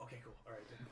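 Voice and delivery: soft relieved mutter; calm yourself down under your breath; quiet relief. Acted. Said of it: "And I'm like, and I'm like".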